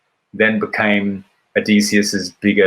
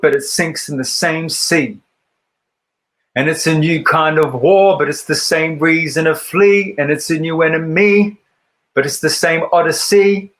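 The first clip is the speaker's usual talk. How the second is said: higher-pitched, rhythmic poetry recital; spoken-word poem; 6 semitones higher than usual. Acted. Recites their own poem.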